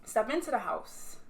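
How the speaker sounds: ordinary talk, nothing marked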